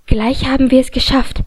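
usual speaking voice

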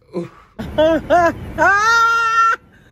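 high, loud squealing laugh. Real, not acted. Swooning over an actor, a shrieking high laugh. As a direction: burst out laughing at the screen.

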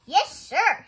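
high, loud, eager reply; eager obedience. A narrator's reading. Amelia Bedelia obeys Mister Rogers's order, eagerly. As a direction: answer up high like a keen recruit.

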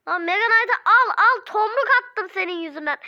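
loud, furious yelling at the opponent; real gamer rage, shouting; angry shout. Real, not acted. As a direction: blast it out at the screen in real rage. Raging at game opponent.

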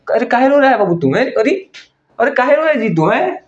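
high, sing-song fake sympathy; mock baby-talk consoling. Vlogger mockingly coos at crying Imran in high baby-talk.

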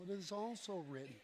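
offhand and soft, no conviction; too cool, casual. Shown as a demonstration. Wrong way to answer the devil, too casual. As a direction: toss it off like it doesn't matter. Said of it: "you can't say it like you're just real cool".

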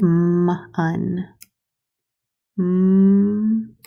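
M-un said slowly, sound by sound. Shown as a demonstration. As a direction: sound out M-un for the children. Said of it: "stretch out".

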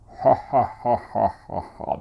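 demonstrated booming "ha-ha" laugh, separate resonant bursts; big resonant foreigner laugh. Shown as a demonstration. Coach imitates foreigners' resonant voices, booming staccato mock laugh. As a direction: imitate a big, ringing English-speaker laugh. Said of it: "響きが大きいんですよね".

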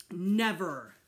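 put-on boy refusing to accept the baby; defiant refusal. A narrator's reading.

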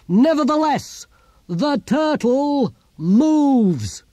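high, loud, very drawn-out proclamation; ringing shout of defiance, each word stretched; defiant shout. Acted. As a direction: cry it out slowly like a battle slogan. Philosopher shouts defiance after throwing lantern.

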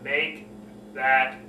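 low, slow, with pauses between words. Shown as a demonstration. Coach modelling how to reconnect, low slow voice. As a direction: bring it down and space the words out. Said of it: "Bring the voice down, slow the voice down".

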